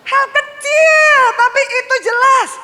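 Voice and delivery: high, loud, slow preacher's cry; preaching shout. Acted. Preacher calling out to the congregation, loud and high. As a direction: proclaim it to the hall.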